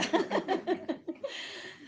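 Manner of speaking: high-pitched burst of laughter; real amused laugh. Real, not acted. Teacher laughing at a student's wish for pizza, amused.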